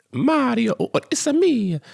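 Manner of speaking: high, bouncy "Mario, oh, it's a me" in a cartoon Italian accent; mock Italian accent. Arguing with a friend, quoting Mario in a mock Italian accent. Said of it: "in the same accent".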